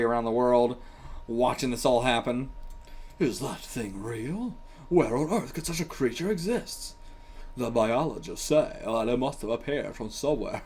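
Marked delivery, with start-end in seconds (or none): 3.2-10.6 a hammed-up, badly faked American accent reading the soldiers' lines. Reading manga soldiers' lines to viewers, in a bad American accent. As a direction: put on a cheesy American accent for the manga dialogue. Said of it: "bad American accents".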